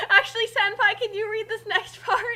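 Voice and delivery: embarrassed laughing. Real, not acted. Flustered asking senpai.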